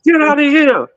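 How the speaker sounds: stunned, can't accept the news; shocked disbelief. Retold or imitated. Hearing his friend died, shocked high disbelief. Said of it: "I was in a state of shock".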